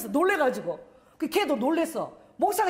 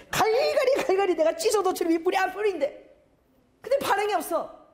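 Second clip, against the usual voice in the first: excited, high-pitched retelling; 6 semitones higher than usual. Retold or imitated. Tearing paper excitedly.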